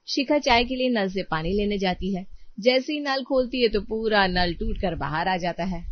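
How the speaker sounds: ordinary delivery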